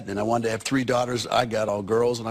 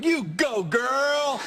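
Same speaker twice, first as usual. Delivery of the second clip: high-pitched, whooping audience cheer; mock rallying cry, pitched way up; imitated crowd cheer; 12 semitones higher, much slower than usual. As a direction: cheer her on like a whole crowd. Acts out audience cheering Annie on.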